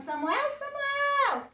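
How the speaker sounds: high, slow, drawn-out name call; singsong summons held long. Acted. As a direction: call out as if from afar. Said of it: "ele ouviu uma voz chamando".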